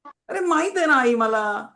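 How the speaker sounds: high, loud mock protest; imitated fed-up kid's retort; exasperated child. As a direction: whine back at a nagging mother. Child's irritated retort to nagging parent.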